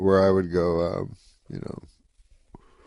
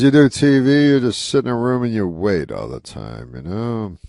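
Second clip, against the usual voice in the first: louder, higher griping Rodney; Rodney Dangerfield impression; 4 semitones higher, 7 dB louder than usual. Rodney complaining to Norm about TV, louder griping. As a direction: complain like the old comic about waiting around. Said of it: "He goes".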